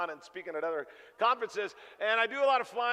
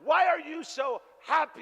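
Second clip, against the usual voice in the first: higher, louder, slowed incredulous question; 8 semitones higher, 5 dB louder than usual. Retold or imitated. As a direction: ask it in loud disbelief. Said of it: "I said".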